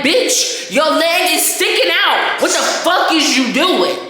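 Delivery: high, loud, disbelieving sass; mock a friend gawking at your broken leg; incredulous call-out. Mocking onlookers at a broken leg, loud and incredulous. Acted outraged friend quote.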